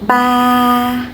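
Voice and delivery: "Ba" held long on one level pitch; flat tone. Shown as a demonstration. Teacher modelling a no tone word, flat and long. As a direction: say "Ba" flat, without any movement. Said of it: "practice with some word no tone".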